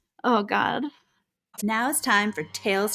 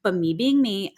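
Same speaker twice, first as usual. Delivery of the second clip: ordinary delivery, nothing marked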